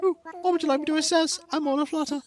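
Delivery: high, fluttery play-acted curator; aflutter museum host. Curator asked to assess fossils, high play-acted flutter. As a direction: answer high and excited, as the put-on host.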